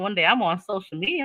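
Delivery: ordinary delivery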